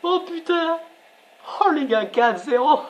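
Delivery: high, giddy exclamations; can't believe it, still buzzing; thrilled player after the fourth goal; elated disbelief. Real, not acted.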